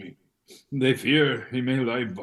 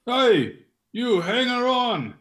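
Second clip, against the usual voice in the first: called out taunt; 10 semitones higher than usual. Retold or imitated. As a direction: shout the insult across the street. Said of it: "one of the shopkeepers called after me".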